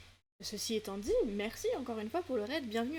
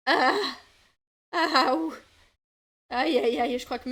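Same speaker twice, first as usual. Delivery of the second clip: loud ow-ow-ow, ears hurting; real pained groans; 3 semitones higher, 10 dB louder than usual. Real, not acted. Streamer to viewers while audio hurts, loud pained groans. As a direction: groan loudly through the pain. Sound still too loud in headset.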